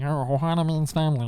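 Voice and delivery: garbled little alien cartoon voice; party-trick cartoon creature impression; Stitch. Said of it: "Stitch voice at a party".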